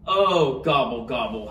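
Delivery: understated howl, falling low; howled gobble. A narrator's reading. Turkey foiled by the elf, mild falling howl. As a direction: let a mild howl sink downward. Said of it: "howled Turkey".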